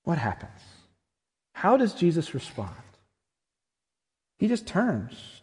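ordinary talk

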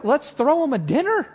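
high, loud burst of enthusiasm; excited suggestion. Acted. Hosts planning a thank-you for Jesus, excited suggestion. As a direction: pitch it up like an eager host's bright idea.

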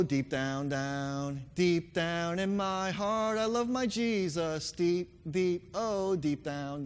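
ordinary talk, nothing marked

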